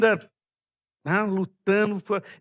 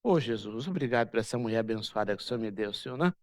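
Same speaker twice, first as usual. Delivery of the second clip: lower, fast, mock-devout prayer voice; mock pious prayer; 7 semitones lower, 4 dB quieter than usual. Pastor mocking a fiancé's pious prayer to the church, lower and fast. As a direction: rattle it off like a smug pious prayer. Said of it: "A Ana virou pra ele".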